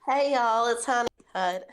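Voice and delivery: loud, cheery Southern greeting; bright, loud Southern howdy; Southern accent. Acted. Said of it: "so my accent isn't great right now".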